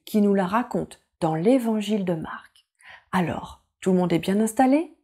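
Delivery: ordinary delivery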